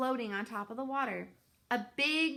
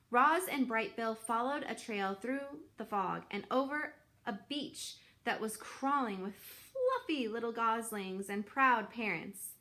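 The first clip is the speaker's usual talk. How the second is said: ordinary delivery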